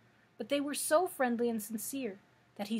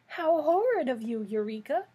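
higher, louder scolding cry; indignant cry; 3 semitones higher, 6 dB louder than usual. A narrator's reading. Dorothy scolds the kitten for striking her hen, crying out. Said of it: "cried Dorothy".

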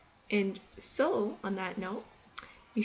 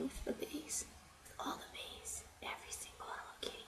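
whispering; 6 semitones higher, 16 dB quieter than usual. Real, not acted. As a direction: whisper that fades almost to nothing. Whispered aside fading out.

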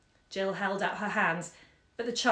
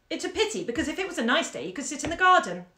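put-on high, quick young girl's voice; higher-pitched organising daughter; fussing child; 7 semitones higher, 4 dB louder, faster than usual. A narrator's reading. Daughter regretting the garden is out for her mother, fussing child.

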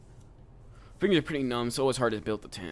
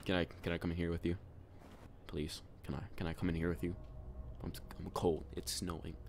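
soft, pitiful begging; quiet pleading voice; 2 semitones lower, 9 dB quieter than usual. Acted. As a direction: plead quietly like a lost stranger.

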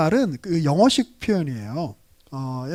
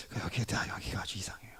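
unvoiced, much softer aside; whispered aside; 10 semitones lower, 16 dB quieter than usual. Real, not acted. Preacher confiding an odd detail to the class, whispering. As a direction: lean in and whisper it.

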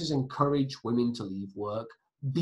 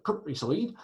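higher, hammed-up West Country lilt; West Country accent; 4 semitones higher than usual. Teacher playing country folk to pupils, in a mock West Country accent. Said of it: "Sorry for the West Country accent".

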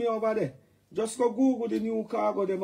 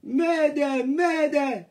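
loud, higher mock distress call; mock mayday call; 6 semitones higher, 5 dB louder, much slower than usual. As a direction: call out mayday like a hammed-up alarm.

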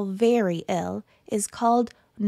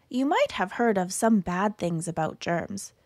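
ordinary delivery

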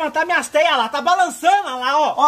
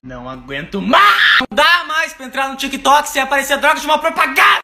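fed-up rant. Acted. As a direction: yell it like you've snapped at the ads. Fed-up rant about TikTok ads.

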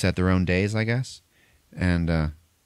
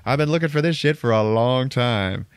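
loud, higher mock villain yell; villain's gloat; 8 semitones higher, 4 dB louder than usual. Villain revealing himself to the boy, loud mock gloat. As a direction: belt it out like a triumphant bad guy. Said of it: "And he's like".

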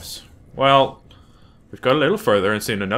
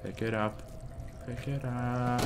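hushed repeat; 13 dB quieter than usual. Real, not acted. Player repeating the order to pick it up, hushed. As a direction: say it under your breath.

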